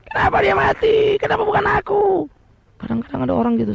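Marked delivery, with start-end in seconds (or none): 0.1-2.0 self-styled hero. Retold or imitated. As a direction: cry out as if you should have died instead. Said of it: "sok pahlawan".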